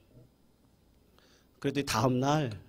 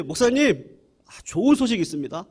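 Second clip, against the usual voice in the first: higher, fast, bursting with joy; beaming good news; 6 semitones higher, 5 dB louder than usual. Retold or imitated. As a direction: rush in grinning with news. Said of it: "빵긋 웃으면서". (활짝 웃으며 신나게 전하는 말투)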